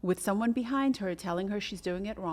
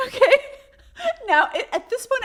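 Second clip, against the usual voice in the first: laughing; 10 dB louder than usual. Real, not acted. Laughing about the tire comment.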